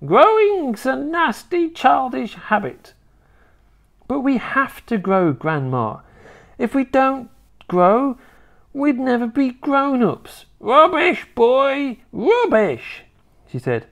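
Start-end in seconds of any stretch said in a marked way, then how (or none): 0.0-2.9 high, slow scolding grandma; snapped retort. A narrator's reading. Grandma answering her grandson's protest, snappish. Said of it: "she snapped". 4.1-10.4 put-on high, earnest boy's voice; protesting little boy. George reasoning with his grandma, protesting. 10.6-13.0 high, loud scorn; dismissive old grandma. Acted. Grandma brushing off her grandson's argument, scornful.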